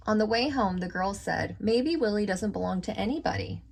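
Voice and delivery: lively storybook reading voice; reading to children. A narrator's reading. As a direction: read it out like to a class of kids. Reads picture book aloud.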